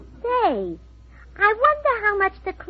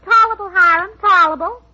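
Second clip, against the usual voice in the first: louder, higher put-on folksy farmer; farmer talk; 4 semitones higher, 6 dB louder than usual. Wife answering worried husband as a farmer, hearty folksy farmer talk. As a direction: play the country farmer for all it's worth. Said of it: "that's farmer talk".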